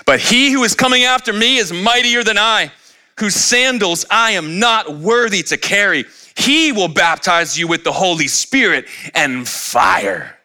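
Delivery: high, slow booming preacher voice; fiery preacher. Acted. Quoting John the Baptist preaching.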